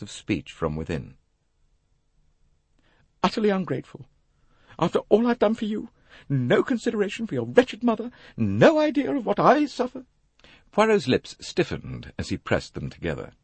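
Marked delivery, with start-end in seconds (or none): 3.2-10.0 very high, self-pitying scolding; aggrieved mother. Acted. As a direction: rail at an ungrateful daughter, pitched way up. Mother's scolding overheard, acted.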